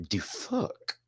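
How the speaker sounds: shocked reaction. Retold or imitated. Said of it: "my initial reaction was".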